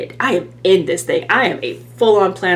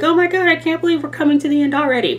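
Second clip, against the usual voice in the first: high, fast, breathless rush of surprise; excited disbelief; 10 semitones higher, faster than usual. Pretend. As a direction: blurt it out in giddy disbelief. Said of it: "or you're like".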